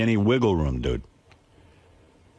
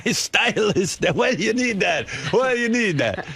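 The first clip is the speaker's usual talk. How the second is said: put-on high, excited broken English in a thick Italian accent; 12 semitones higher, slower than usual. Italian watch salesman quizzing a customer about his stylist, thick Italian accent. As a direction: imitate a fresh-off-the-boat Italian salesman, hammed up and high. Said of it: "he had some foreign accent".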